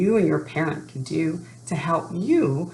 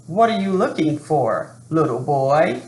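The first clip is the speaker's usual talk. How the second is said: a gruff man's voice, a touch put on; lightly voiced gruff stranger; 4 dB louder than usual. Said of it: "A gruff voice startled me".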